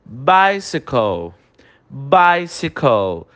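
bicycle said very slowly; slow English pronunciation. Shown as a demonstration. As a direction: model bicycle for repetition. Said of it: "você vai repetir a pronúncia". (bicycle bem devagar em inglês)

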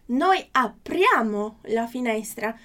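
slow, clear Italian model reading; careful teacher pronunciation; slow model sentence. Shown as a demonstration. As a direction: read it slowly for students to repeat. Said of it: "Próxima frase".

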